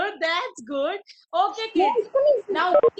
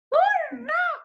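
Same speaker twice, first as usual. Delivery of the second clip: hurrah sung up high and bright; high cheer; 9 semitones higher than usual. Acted. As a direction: cheer it like a happy child. Cheer inside the song.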